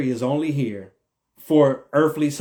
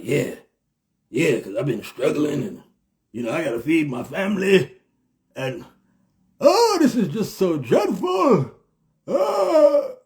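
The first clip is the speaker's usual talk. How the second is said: mock victim. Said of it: "you just be like".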